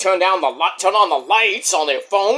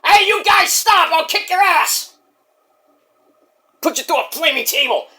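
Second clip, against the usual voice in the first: much higher, fast ranting threats; a hothead losing his temper at both; [name] angry voice; 9 semitones higher than usual. Acted. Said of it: "Okay, [name], calm down".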